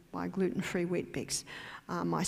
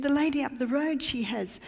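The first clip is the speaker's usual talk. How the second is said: higher, slowing mock indignation; indignant patient; 7 semitones higher than usual. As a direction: retell her protest, pitch rising high. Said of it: "She said".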